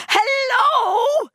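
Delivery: screamed high and loud into the phone; terrified scream. A narrator's reading. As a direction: shriek it in panic. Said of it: "she screamed".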